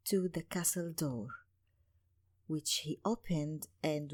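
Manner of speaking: hushed storytelling. A narrator's reading. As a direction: draw listeners close, quietly. Hushed narration inside castle.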